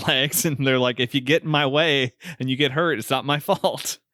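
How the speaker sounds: usual speaking voice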